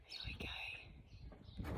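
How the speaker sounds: soft whispered aside. Real, not acted. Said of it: "whispered aside to listener".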